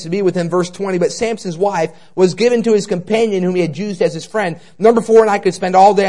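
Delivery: preacher listing points to the congregation, loud; preaching at full force. Acted. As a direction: drive the points home to the congregation.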